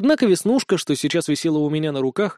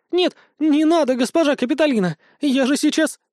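high, squealing protest; giggly pleading; 10 semitones higher than usual. A narrator's reading. Vesnushka begs the tickling captain to stop, squealing.

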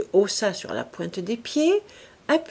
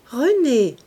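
surprised at her name; 5 semitones higher, 7 dB louder than usual. A narrator's reading. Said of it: "Tiens, il y en a une qui se nomme comme moi".

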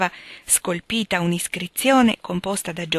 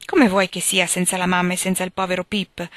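ordinary delivery, nothing marked

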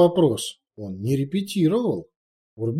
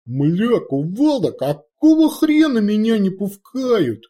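higher, louder orc bellow; angry yell; 10 semitones higher, 6 dB louder, slower than usual. Acted. Orc chief storms through the door, yelling angrily. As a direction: burst in yelling indignantly.

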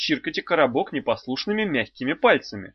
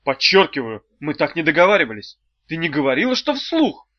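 loud, indignant protest; 2 semitones higher, 5 dB louder than usual. Acted. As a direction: push back, offended.

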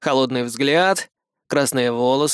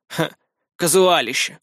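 scornful snort. A narrator's reading. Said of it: "фыркнул Лиам".

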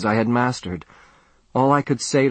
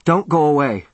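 pleading, raised and urgent; begging; 5 semitones higher, 4 dB louder than usual. A narrator's reading. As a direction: beg her not to leave. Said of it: "I begged".

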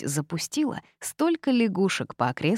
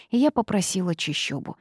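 usual speaking voice

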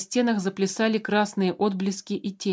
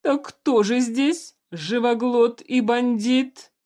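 slow sinister ruler voice; dark lord; 4 semitones higher, 4 dB louder, slower than usual. A narrator's reading. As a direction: menace them slowly from the throne. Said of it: "зловещим голосом повторил".

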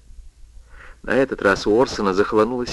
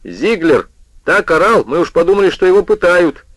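agitated, raised voice; 7 semitones higher, 4 dB louder than usual. A narrator's reading. Neighbour complaining about yelling.

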